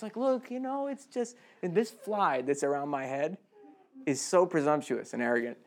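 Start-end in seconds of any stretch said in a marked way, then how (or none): 0.2-1.4 much higher, much softer, limp helpless whine; helpless shrug. Retold or imitated. Stalled student excusing himself: meek helpless whine. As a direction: sound like you've already given up. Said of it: "learned helplessness".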